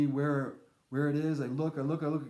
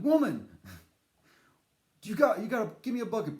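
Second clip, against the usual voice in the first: bossy cowboy voice; 7 semitones higher, 5 dB louder, faster than usual. Pretend. Demanding a bucket from the woman, hammed-up bossy. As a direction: bark it like a cartoon tough guy. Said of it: "I didn't say it like that".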